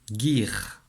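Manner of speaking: Guir said slowly and clearly, hard g; dictation syllable. Shown as a demonstration. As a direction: repeat Guir clearly to the pupil, slow and clear.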